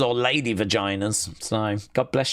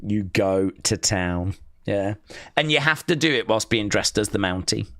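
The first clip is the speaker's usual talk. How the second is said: usual speaking voice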